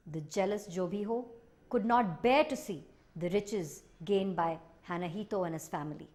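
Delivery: ordinary delivery, nothing marked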